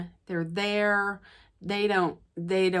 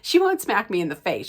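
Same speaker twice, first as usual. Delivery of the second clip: ordinary delivery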